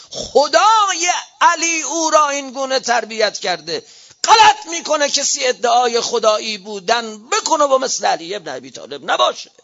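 high, loud pulpit harangue; fiery preaching. Real, not acted. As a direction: thunder at the clerics from the pulpit. Preaches at full force.

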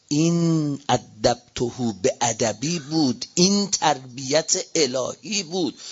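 slow, low, weighty pulpit delivery; solemn preaching. Real, not acted. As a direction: measure out each phrase slowly.

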